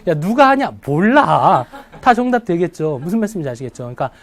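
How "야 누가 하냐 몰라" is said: loud, playful question and blurted answer; acted casual exchange. Teacher acting a who-question and its know-nothing answer, loudly hammed-up.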